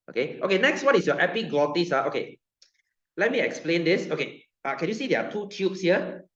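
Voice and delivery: ordinary talk, nothing marked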